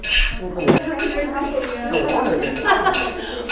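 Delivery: a light, amused chuckle; amused laugh. Acted. Spirit watches Fred's friends and laughs, amused. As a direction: laugh along with the happy party.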